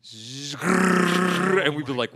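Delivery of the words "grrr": loud imitated minigun whir; minigun noise. Player imitates the minigun they would hear coming, loud grinding roar. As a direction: growl out a spinning gun's roar.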